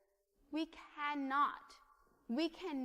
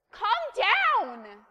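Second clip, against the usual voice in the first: high, loud exasperated shout; full-force "calm down" yell; yelled command; 9 semitones higher, 15 dB louder than usual. Acted. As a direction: yell it like a fed-up parent at a small child. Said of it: "the same way that I yell".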